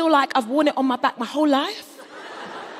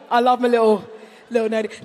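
ordinary talk, nothing marked

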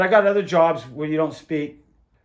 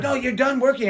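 bossy cutoff; 9 semitones higher, 3 dB louder than usual. Retold or imitated. Boss cutting him off at work.

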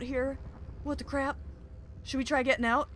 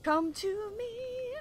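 high, drawn-out "come to me"; coaxing call; 9 semitones higher, much slower than usual. Real, not acted. Gamer calling to a fragment, coaxing. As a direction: sing it out slowly, much higher.

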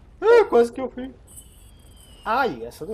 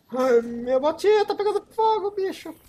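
alarmed; 9 semitones higher, 6 dB louder than usual. Real, not acted. Boot on fire in game.

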